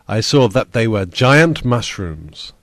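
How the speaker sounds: usual speaking voice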